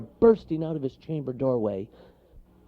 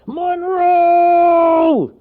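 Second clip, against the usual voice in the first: very loud drawn-out holler; bellowing bear, high and strained; gruff foghorn call; 15 dB louder than usual. A narrator's reading. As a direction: boom the name through the forest. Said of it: "His gruff voice carried like a foghorn".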